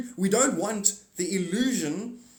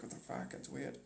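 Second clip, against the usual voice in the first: much softer, muttered to himself; baffled under breath; 4 semitones lower, 17 dB quieter than usual. Retold or imitated. Reacting to the neighbor's shout, soft puzzled mutter. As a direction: mutter it half to yourself. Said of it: "I'm like".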